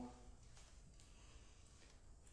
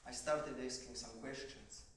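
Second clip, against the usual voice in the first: ordinary talk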